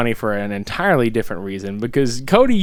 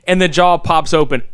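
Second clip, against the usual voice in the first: ordinary talk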